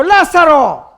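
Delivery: loud, slow, drawn-out call; commanding shout. Acted. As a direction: summon someone out from afar. Acts Jesus calling Lazarus out. (grito de mando, fuerte y alargado)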